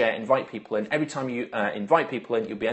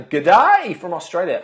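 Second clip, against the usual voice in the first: hammed-up greeting; 4 semitones higher, 8 dB louder, slower than usual. Hammed-up greeting to Australian viewers.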